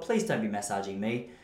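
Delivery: ordinary talk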